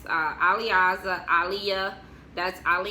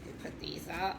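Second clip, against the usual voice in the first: whispered aside; 10 dB quieter than usual. Real, not acted. Muttering while tidying photos.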